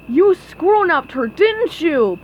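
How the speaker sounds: loud, high accusing blurt. A narrator's reading. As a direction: blurt it out before thinking. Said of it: "Patrick blurted out".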